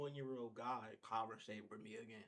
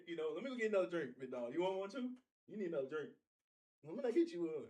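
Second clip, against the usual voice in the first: eager pushy guy; 5 semitones higher, 7 dB louder than usual. Retold or imitated. Voicing the older guy offering drinks.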